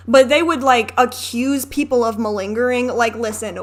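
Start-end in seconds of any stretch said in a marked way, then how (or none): none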